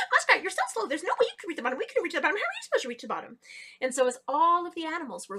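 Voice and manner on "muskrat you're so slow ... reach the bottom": high giggle into a rushed jeer; mocking laughter. A narrator's reading. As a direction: laugh at her, then taunt quickly. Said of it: "started laughing at her".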